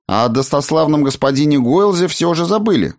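high-pitched huffy retort; snorting. A narrator's reading. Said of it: "фыркнула Клара". (фыркая, повышенным тоном)

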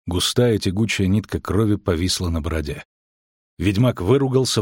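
usual speaking voice

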